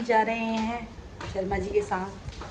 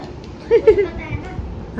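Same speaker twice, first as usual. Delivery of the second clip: laughing; 7 semitones higher, 6 dB louder than usual. Real, not acted. Laughs at the child.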